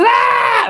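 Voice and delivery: high, loud mock shriek; retelling a customer's shriek; imitated customer yelp. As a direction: squeal like the startled customer. Customer yelps at her.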